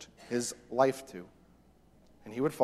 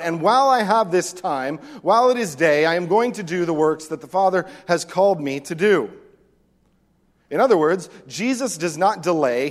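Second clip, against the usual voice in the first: loud, driving sermon voice, higher than his own; full-force preaching; 5 semitones higher, 7 dB louder than usual. Acted. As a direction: push it hard from the pulpit. Preacher builds to loud peak.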